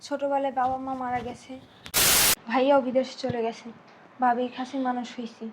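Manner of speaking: very soft, subdued sorrow; sad confiding. Acted. Orphaned girl explaining her life to stranger, sad confiding. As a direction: confide it quietly, with pauses.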